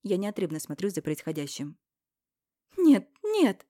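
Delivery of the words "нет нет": much higher, loud female cry; Irina pulling them apart. Acted. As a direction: scream to stop the fight. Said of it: "Останавливает и разнимает их Ирина". (Ирина кричит, разнимая, намного выше)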